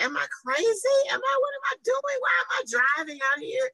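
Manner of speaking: very high, disbelieving voice; incredulous self-talk. Retold or imitated. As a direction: ask yourself in high mock disbelief. Retelling her own doubts.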